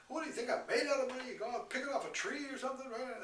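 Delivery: fast, high and loud angry rant; retold explosion. Father yelling at his kids that money doesn't grow on trees, fast angry shout. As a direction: fire the words off in an outburst. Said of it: "I exploded".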